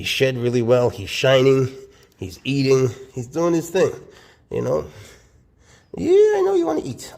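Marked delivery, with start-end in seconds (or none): 6.0-7.1 much higher and louder sing-song; pet voice. Real, not acted. Talking to hungry snake.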